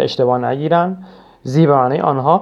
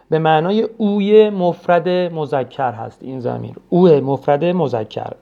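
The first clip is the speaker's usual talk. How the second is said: ordinary talk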